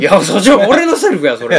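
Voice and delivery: laughing at a puzzled colleague, loud retort. Retold or imitated. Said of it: "みたいなね".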